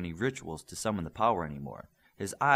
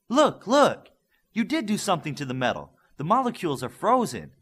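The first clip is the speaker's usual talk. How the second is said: bursting out high and loud with discovery; thrilled scientist crying out at the scanner; excited; 9 semitones higher, 8 dB louder than usual. Acted. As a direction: shout it like you just saw the impossible. Scientist spots frozen molecules.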